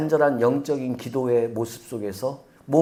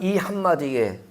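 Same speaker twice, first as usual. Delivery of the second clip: normal talking voice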